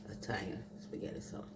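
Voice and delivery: quick whispered aside. Real, not acted. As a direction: whisper it quickly under the breath. Aside after naming her sauce.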